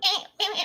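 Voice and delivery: high squeaky dolphin chatter; chirpy clicking dolphin. Pretend. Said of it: "terrible impression of a dolphin".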